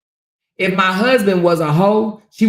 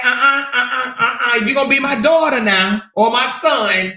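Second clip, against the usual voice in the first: scolding mama; 3 semitones higher than usual. Pretend. Pretend mama telling her daughter to stop drinking, scolding. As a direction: play the mama laying down the law. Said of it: "I'm her mama".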